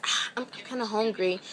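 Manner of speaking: imitating his hushed, breathy aside; retold whisper. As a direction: lean in softly, mimicking a whispered hint. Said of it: "Then he get whispers".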